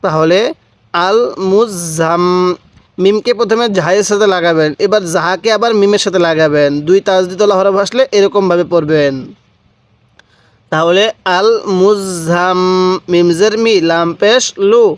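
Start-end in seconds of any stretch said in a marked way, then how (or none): 0.9-2.2 "আল মুজাম" very slow, final m held long through the nose; ghunnah. Shown as a demonstration. Teacher showing learners the obligatory ghunnah, slow nasal hold. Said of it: "নাকের বাসি দ্বারা গুন্নাহ করবেন". 11.4-12.6 "আল মুজাম" very slow, final m held long through the nose; ghunnah. Shown as a demonstration. Teacher showing learners the obligatory ghunnah, slow nasal hold. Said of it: "নাকের বাসি দ্বারা গুন্নাহ করবেন".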